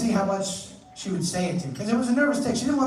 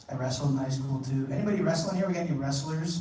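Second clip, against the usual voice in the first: usual speaking voice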